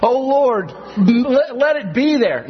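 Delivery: high, loud mock pleading prayer; mock plea. Preacher jokingly praying the verse were real, loud mock plea. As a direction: beg the Lord in jest. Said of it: "we want it to be there".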